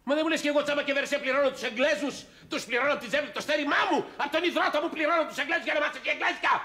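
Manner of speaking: ψηλά και δυνατά, αγανακτισμένος πατέρας; ξεσπά για τα λεφτά των μαθημάτων; θυμωμένο ξέσπασμα. Acted. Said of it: "Τι έπαθε, καλέ". (αγανακτισμένο ξέσπασμα)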